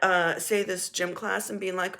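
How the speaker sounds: ordinary talk, nothing marked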